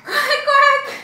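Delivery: high, loud laughing quack. Acted. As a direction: quack like a silly duck. Duck sound with laugh.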